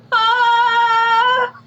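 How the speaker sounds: very high, loud ghost woman wail; ghostly wail. Pretend. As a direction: shriek like a wailing ghost woman. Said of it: "should I moan?".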